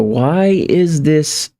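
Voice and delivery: ordinary talk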